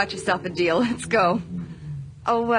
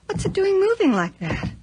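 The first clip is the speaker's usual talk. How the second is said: alarmed; 5 semitones lower than usual. Acted. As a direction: sound spooked by the moving toy. Rocking horse moves by itself.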